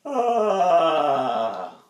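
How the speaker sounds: loud falling ah; demo vocal sound. Shown as a demonstration. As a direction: push out a long ah. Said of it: "This is a really nice sound".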